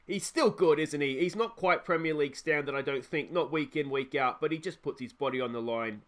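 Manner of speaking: ordinary talk, nothing marked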